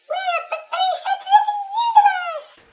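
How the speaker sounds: extremely high, squeaky voice; excited high-pitched eep; eeping nurse. A narrator's reading. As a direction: squeal it loudly like a tiny creature. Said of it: "eeped the nurse".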